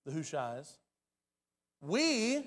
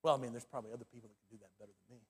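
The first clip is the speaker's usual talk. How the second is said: mock excuse; 9 semitones lower, 7 dB quieter, faster than usual. Acted excuse after pledging service.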